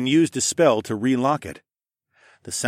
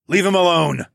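loud, raised bellow of command; 8 semitones higher, 5 dB louder than usual. A narrator's reading. Dragon defends a friend against the assassin, bellowing. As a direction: bellow it to call off an attacker. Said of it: "I bellowed".

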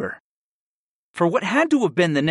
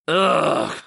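short groan, pitched up; exasperated groan; 5 semitones higher than usual. A narrator's reading. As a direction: groan out an ugh. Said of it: "I groaned".

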